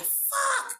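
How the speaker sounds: sudden high-pitched exclamation; mock alarm. Couple in the shed before they run, mock panic.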